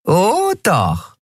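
high voice with a mean grin; gloating. A narrator's reading. Card player answers the pleading boy, meanly gloating. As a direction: relish the threat, pitched high. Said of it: "Nelen grinste fies".

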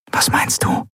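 whispered question. A narrator's reading. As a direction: whisper back so the guard can't hear. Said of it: "flüsterte Endriel zurück".

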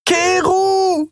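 very high, drawn-out desperate call; long high-pitched scream; screamed name. Acted. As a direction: cry the name after someone leaving. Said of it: "schrie sie".

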